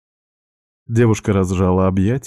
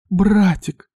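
put-on high, girlish cry of delight; joyful greeting; 11 semitones higher than usual. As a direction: greet him in a high, excited voice. Sister bursts in to greet brother. (радостно, высоким девичьим голосом)

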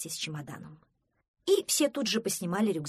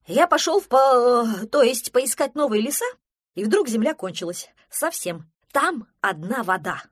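loud, alarmed telling; agitated report; 5 semitones higher, 11 dB louder than usual. Acted. Пронырсон tells of land ending in water, agitated. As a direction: blurt it out loudly in panic.

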